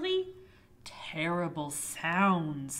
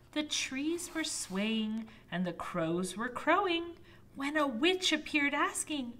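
ordinary delivery

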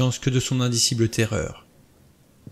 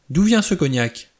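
sharp, higher demand; brusque; 6 semitones higher, 3 dB louder than usual. Acted. Vera demands where the cognac came from, brusque and sharp. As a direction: snap the question out suspiciously. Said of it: "d'un ton brusque".

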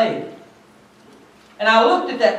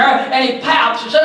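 usual speaking voice

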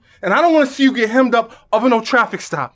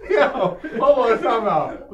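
laughing; much slower than usual. Acted. Laughing at bad dancing.

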